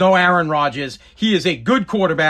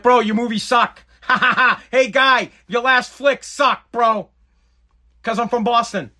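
Boston guy; 5 semitones higher than usual. Retold or imitated. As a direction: taunt a movie star like a Boston bro. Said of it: "I'd be like".

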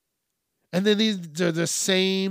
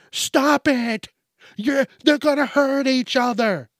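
high, raised mock shout; alarmed bystander yell; 8 semitones higher, 5 dB louder than usual. Onlooker begging fighting animals to stop, mock yelling. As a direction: cry out like a worried onlooker.

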